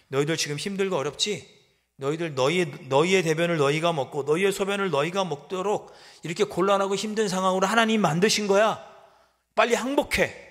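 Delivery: jeer, then bark the surrender demand; enemy mocking the starving city; mocking taunt building.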